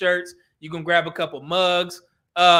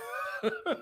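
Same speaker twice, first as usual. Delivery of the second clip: short high-pitched airy laugh; 10 dB quieter than usual. Real, not acted. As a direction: let out a quick squeaky laugh. Laughs at DoorDash idea.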